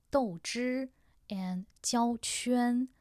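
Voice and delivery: 豆汁 and 焦圈 said without the final r, each word two clear syllables; two separate syllables. Shown as a demonstration. Host shows learners the plain form first, slow and careful. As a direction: read 豆汁 and 焦圈 slowly as plain two-syllable words. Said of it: "both two syllables".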